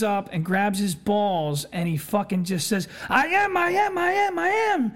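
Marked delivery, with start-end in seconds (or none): 3.1-4.9 freaking out. Retold or imitated. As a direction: lose it and scream out. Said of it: "and freaks the fuck out".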